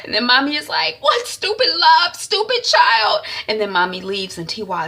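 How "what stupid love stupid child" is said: high, loud, drawn-out rebuke; exasperated scolding. Retold or imitated. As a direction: scold her as a furious mother. Mother scolds daughter.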